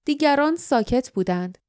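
plain reading voice

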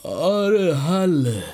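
talking through a long yawn; drawn-out yawn. A narrator's reading. Sleepy man answering his friend, yawning. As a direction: stretch the words out in a yawn.

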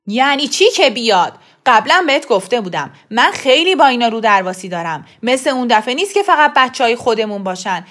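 loud heated rant; angry father. A narrator's reading. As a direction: argue hard with the wife, ashamed. Father angry argument with wife. (پدری عصبانی با فریاد در دعوا)

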